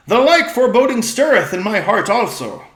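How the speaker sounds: a king admitting a foreboding; regal old-fashioned speech. Acted. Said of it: "their voice has changed".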